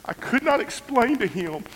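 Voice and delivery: high voice breaking in short halting phrases; anguished, choked up. Real, not acted. Father confessing he had to hurt his child, anguished. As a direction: force each phrase out through tears.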